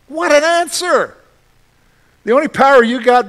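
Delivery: normal talking voice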